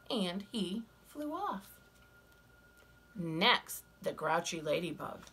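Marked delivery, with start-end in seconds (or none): none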